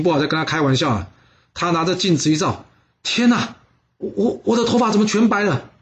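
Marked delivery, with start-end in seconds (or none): 3.0-5.7 higher, stammering in shock; shocked gasp. Acted. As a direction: recoil at the mirror, stuttering.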